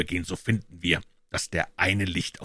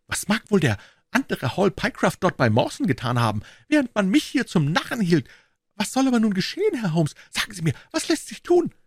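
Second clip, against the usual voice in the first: much higher, pleading in distress; desperate Pycroft; 13 semitones higher, 3 dB louder than usual. A narrator's reading. Pycroft, fists clenched in despair, pleads with Holmes in a higher voice. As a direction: beg Holmes for help. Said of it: "rief er".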